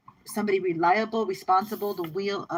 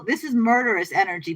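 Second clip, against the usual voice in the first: ordinary talk, nothing marked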